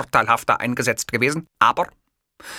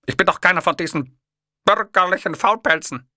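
fast, higher, louder scorn; indignant; 11 semitones higher, 5 dB louder than usual. A narrator's reading. Hitler scorning lazy bourgeois amateurs, indignant and fast. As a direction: spit out the contempt quickly. Indignant retort to kiosk man.

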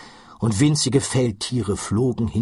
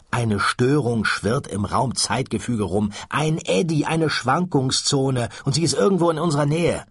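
lightly voiced Ford, higher, rushing at the end; Ford, tense explaining; 6 semitones higher than usual. Ford warning Arthur of the space-time eddy, tense. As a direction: explain the danger with mounting urgency.

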